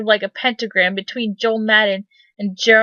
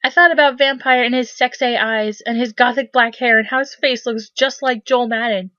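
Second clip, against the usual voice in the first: plain reading voice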